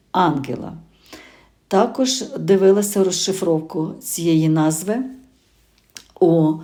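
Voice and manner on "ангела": Ангела stressed on the first syllable, АНгела, falling after. Shown as a demonstration. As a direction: open Ангела on a strong first A. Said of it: "також наголос на А". (наголос на перший склад, АНгела)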